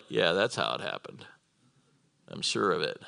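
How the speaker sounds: low, quiet, unconvinced husband; dry disbelief. Retold or imitated. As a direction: mutter it low, not buying a word. Voices a doubting Mister Potiphar.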